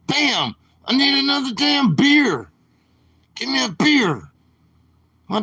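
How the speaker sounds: high-pitched, agitated yelling for beer; worked-up Don Vito. Retold or imitated. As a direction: rant like a drunk on a river boat. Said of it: "That Don Vito would get worked up".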